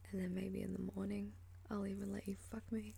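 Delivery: intimate, teasing whisper; sultry voice. Acted. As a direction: whisper it close and seductive. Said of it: "you like my sultry voice".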